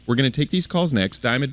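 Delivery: ordinary delivery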